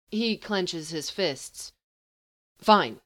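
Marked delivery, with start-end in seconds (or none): 2.6-3.0 curt, louder snap; snapping. Acted. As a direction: bite off the word in anger. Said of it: "he snaps".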